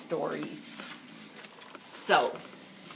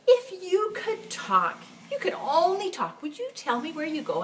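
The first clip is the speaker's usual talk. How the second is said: put-on 1 girl's voice; 9 semitones higher than usual. A narrator's reading. Girl asking her cat.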